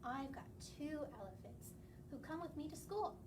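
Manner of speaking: plain reading voice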